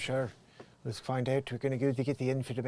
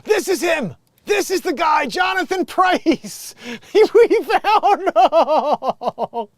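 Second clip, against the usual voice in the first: gasp, then yell it out much higher and louder; triumphant discovery, full-throated; excited shout; 16 dB louder, much slower than usual. Real, not acted. Streamer spots the actor he was searching for, ecstatic shout.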